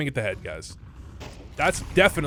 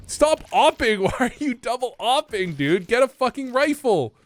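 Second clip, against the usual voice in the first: exasperated yell; 8 semitones higher than usual. Real, not acted. Yelling at player on screen.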